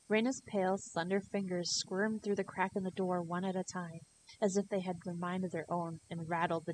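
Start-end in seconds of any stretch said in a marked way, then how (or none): none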